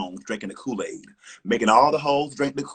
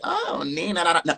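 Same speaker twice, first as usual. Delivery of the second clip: mocking fake-friendly girl; 8 semitones higher than usual. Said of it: "trying to be like".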